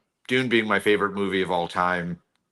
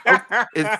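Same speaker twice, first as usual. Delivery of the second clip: surprised laugh; 11 semitones higher, 6 dB louder than usual. Real, not acted.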